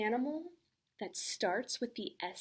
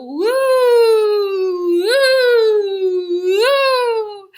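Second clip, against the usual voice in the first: loud, high woo calls; woo rolling cry; 9 semitones higher, 16 dB louder, much slower than usual. Shown as a demonstration.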